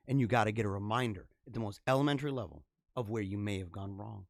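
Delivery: usual speaking voice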